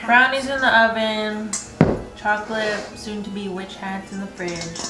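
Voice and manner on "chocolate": ordinary delivery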